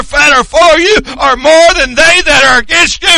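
loud, high shouted preaching; preaching at full force. Acted. Preacher rallying the congregation, shouting. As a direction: build to a rousing climax.

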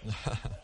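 short quiet laugh under the breath; soft chuckle. Real, not acted. Grandfather chuckles to the church about the shirt, soft laugh. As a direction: laugh softly at the embarrassing memory. Laughs at his own story.

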